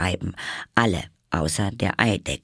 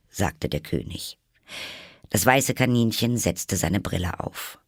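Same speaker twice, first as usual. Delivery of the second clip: ordinary delivery, nothing marked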